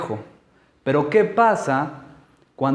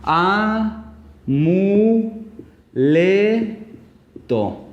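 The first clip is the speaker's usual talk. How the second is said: amuleto syllable by syllable; 3 semitones higher, much slower than usual. Shown as a demonstration. Teacher showing how amuleto divides, syllable by syllable. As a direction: separate each syllable of amuleto, dropping the last. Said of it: "Entonces quedaría como".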